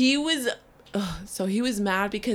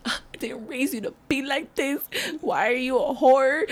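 higher mock wail from an upset mom; imitating mom yelling and crying; 5 semitones higher than usual. Mother scolding her daughter over the podcast, mock tearful yelling. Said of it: "yelling at me, crying".

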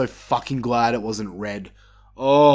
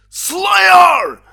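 excited shout; 9 dB louder than usual. Retold or imitated. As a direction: scream the band's name in delight. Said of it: "I'm like".

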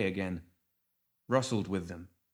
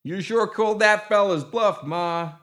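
loud, high gremlin cry; shrill; 12 semitones higher, 8 dB louder, slower than usual. A narrator's reading. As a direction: crow it shrilly over the din. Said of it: "A shrill voice cut through".

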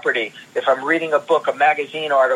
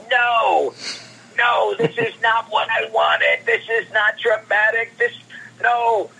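put-on much higher, agitated older man's protest; Stan Lee impression; 9 semitones higher than usual. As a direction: play the fussy legend rejecting artwork. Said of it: "and he goes".

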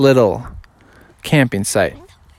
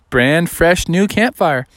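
plain reading voice

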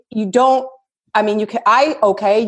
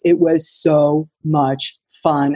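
ordinary talk

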